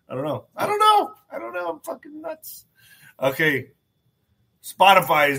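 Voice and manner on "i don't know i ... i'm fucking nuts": silly high voice. Pretend. Silly self-mocking falsetto outburst.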